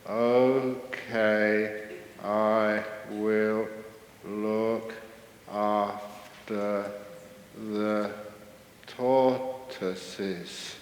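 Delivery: meditator answering the head keeper, extremely slow; word by word with long gaps, blissfully unhurried; very slow talker. Acted. Man too peaceful to talk fast accepts a zoo job, drawn-out slow. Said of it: "who can't even talk fast".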